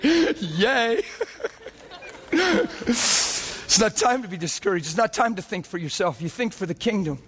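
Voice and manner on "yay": high delighted laugh with a little cheer; joyful laugh. Real, not acted. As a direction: burst out laughing in joy, a bright "yay" in the middle. Preacher laughs at breakthrough.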